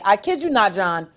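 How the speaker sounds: usual speaking voice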